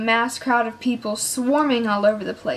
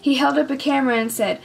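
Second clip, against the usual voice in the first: plain reading voice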